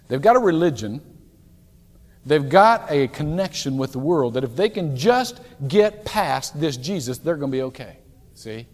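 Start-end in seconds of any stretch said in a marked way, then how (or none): none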